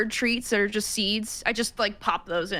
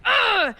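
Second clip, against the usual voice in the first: sharp high grunt, much louder; intimidating grunt; 11 semitones higher, 5 dB louder than usual. Acted. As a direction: snarl a quick "Ugh!" to scare him. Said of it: "I go like".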